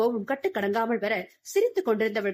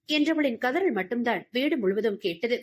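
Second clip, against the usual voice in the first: ordinary delivery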